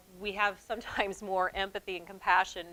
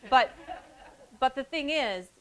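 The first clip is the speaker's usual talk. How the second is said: ordinary talk, nothing marked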